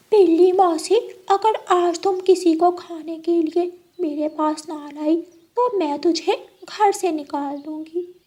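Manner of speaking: high, sly animal voices; a cunning fox bossing the cat; high sly animal voices plotting; scheming fox and cat. Acted.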